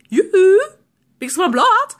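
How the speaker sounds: high, loud yoo-hoo in a salesman lilt; taunting alarm imitation. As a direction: taunt him with the catchphrase. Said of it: "looked him straight in the face and go".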